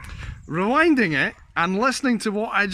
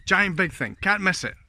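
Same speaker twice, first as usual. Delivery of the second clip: ordinary talk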